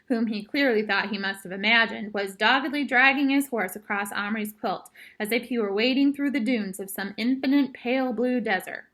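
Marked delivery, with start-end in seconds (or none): none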